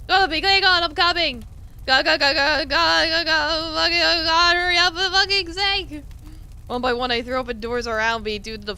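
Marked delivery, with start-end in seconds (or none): 0.1-6.0 higher, louder frantic urging; panicked shouting. Acted. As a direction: yell at yourself to hurry. Frantic search in burning house.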